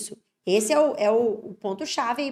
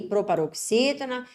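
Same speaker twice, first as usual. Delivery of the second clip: normal talking voice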